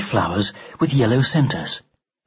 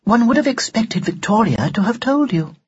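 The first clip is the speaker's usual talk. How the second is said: much higher, formal doll voice; prim talking doll; 9 semitones higher than usual. Acted. Doll surprised that Ned wasn't told her name, primly. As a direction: reprove him in high, precise tones.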